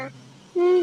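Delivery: ordinary talk, nothing marked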